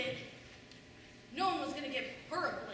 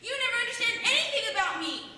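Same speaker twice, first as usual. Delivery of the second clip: high, loud, fast hurt outburst; mock wailing teen, louder and higher; imitated teenage wail; 7 semitones higher, 9 dB louder, faster than usual. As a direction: play the teenage daughter yelling at her mother. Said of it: "She starts sobbing and wailing".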